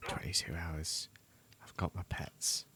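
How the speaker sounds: tired whisper; exhausted, breathy whisper. Real, not acted.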